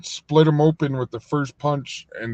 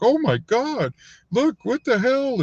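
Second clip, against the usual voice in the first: high, shocked gasping exclamation; shocked girls; 12 semitones higher than usual. Retold or imitated. Passing girls gasp at beaten inmate, high and shocked. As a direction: gasp in disbelief at the sight.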